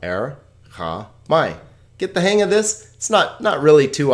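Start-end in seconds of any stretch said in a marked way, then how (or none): 0.0-1.6 Erchamai slowly split as Er, cha, mai; syllable by syllable. Shown as a demonstration. Teacher repeating the three syllables, Erchamai slowly split apart. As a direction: pause between each syllable of Erchamai. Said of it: "three syllables".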